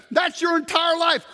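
preacher's slow emphatic shout. Real, not acted. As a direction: hammer the point to the crowd. Preacher driving home point.

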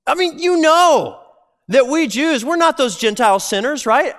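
high-pitched confrontation with Peter; Paul confronting Peter. Retold or imitated. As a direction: get right in his face and demand an answer. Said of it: "He's like".

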